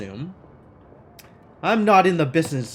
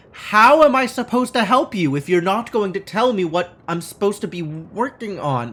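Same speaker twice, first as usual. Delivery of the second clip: ordinary delivery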